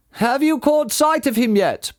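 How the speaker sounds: calling out. Acted. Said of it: "Bean called back".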